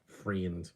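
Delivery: "Friend" as a low, soft "freend"; mimicked mispronunciation. Streamer echoing the Kiwi accent to himself, "Friend" as quiet "freend". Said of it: "not friend".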